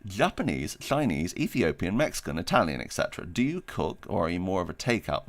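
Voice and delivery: ordinary talk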